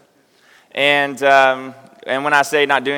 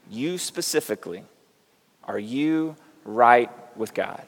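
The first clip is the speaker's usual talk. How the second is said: hushed preaching; 6 dB quieter than usual. Acted. Solemn altar question to congregation.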